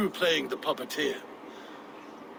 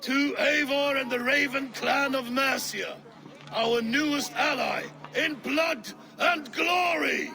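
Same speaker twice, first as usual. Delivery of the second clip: higher, proclaiming to the hall; rousing toast; 10 semitones higher than usual. Acted. King toasting Eivor before the hall, rousing shout. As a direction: raise a toast to the new ally.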